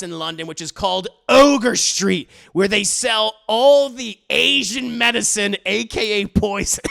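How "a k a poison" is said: laughs loudly at the gag; laughing. Real, not acted. Cracking up again at the poison gag, laughing. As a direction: burst out laughing.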